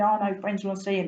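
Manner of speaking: a chatty cockney fish from the Thames; London fish explaining his trouble to the boy. Acted. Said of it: "You can tell I'm from London".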